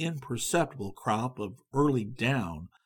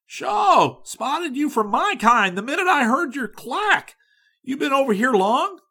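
eager chatter; 12 semitones higher, 7 dB louder than usual. A narrator's reading. Young man keeps chattering.

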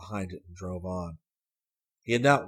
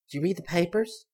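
put-on higher, louder girl's voice teasing; sly girl; 10 semitones higher, 4 dB louder than usual. A narrator's reading. Girl surprising Hayes from the back seat, teasing. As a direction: pipe up high and pleased with herself.

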